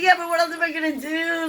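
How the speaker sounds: mock carefree reply; nonchalant. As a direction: brush it off lightly. Retold casual reply.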